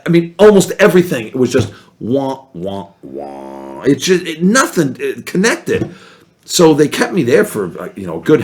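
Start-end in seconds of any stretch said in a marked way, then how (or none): 2.5-3.8 mock sad trombone. Imitating failed jokes.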